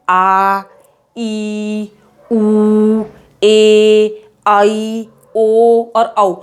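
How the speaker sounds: teacher demonstrating long vowels. Shown as a demonstration. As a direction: stretch each long vowel out for the class. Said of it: "तो दीर्घ स्वर हमारा क्या है?".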